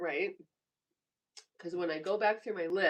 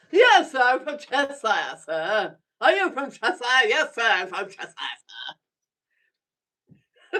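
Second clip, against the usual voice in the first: much louder hammed-up British yes sir; mock British accent; 2 semitones higher, 9 dB louder than usual. Answering as the British viewer, hammed-up accent. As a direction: play a proper English chap.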